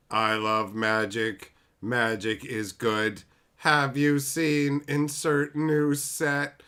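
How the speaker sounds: slow, wooden mock impression; lobotomized PR man. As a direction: drone it out slowly as if lobotomized. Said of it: "like they fucking lobotomized him".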